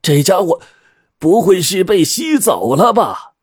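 high, halting acted worry; puzzled alarm. A narrator's reading. Captain looking round for the missing man, stammering high-pitched alarm. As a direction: stammer it high, then stretch the question. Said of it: "气道".

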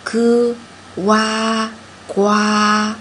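咯, 哇, then 呱, one sound at a time; sounding out words. Shown as a demonstration. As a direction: build 呱 from 咯 and 哇, step by step. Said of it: "我们拼读的时候一步一步来".

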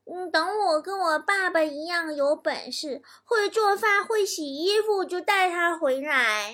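high, slow kiddie voice; little boy. Acted. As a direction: speak slowly in a put-on child's voice. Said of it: "熊孩子说".

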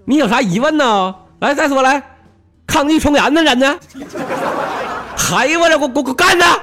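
put-on high, loud Jinzhou accent, every line swinging up; cocky Jinzhou guy talking back to the police; hammed-up Jinzhou accent; bossy Jinzhou man. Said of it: "我锦州人就是霸道".

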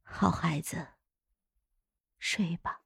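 very soft, low hush; gentle coaxing. A narrator's reading. Dowager closing dead consort's eyes, soft low coaxing. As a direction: lull them to sleep, almost whispering. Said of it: "柔声哄道".

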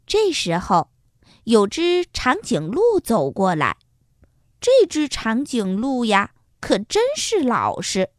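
ordinary delivery, nothing marked

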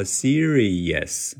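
serious split into separate beats; word by word. Shown as a demonstration. Teacher shows the overly slow choppy serious. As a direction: say serious one syllable at a time. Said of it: "一字一顿地说".

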